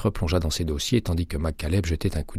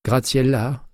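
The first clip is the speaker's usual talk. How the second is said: drawn-out Latin name; 8 semitones higher, 4 dB louder, slower than usual. A narrator's reading. Patrice hailing her colleague, drawn-out Latin flair. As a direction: stretch the syllables of Graziella with a Latin lilt, higher and louder. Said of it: "en traînant sur les syllabes et accentuant ses intonations latines".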